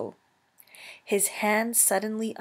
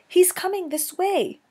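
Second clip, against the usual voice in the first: put-on high, louder, urgent young voice; alarmed warning; 10 semitones higher, 5 dB louder than usual. Gosling warning his mother of the hunter, high and alarmed. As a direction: warn her in a high, anxious voice.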